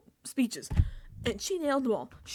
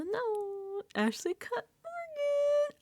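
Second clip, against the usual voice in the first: high, slow, crying-out joy; overjoyed high wail about the winner; ecstatic squeal; 13 semitones higher, much slower than usual. Real, not acted. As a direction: stretch it out in a high wail of delight. Overjoyed correction of a win.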